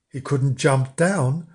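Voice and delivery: ordinary delivery, nothing marked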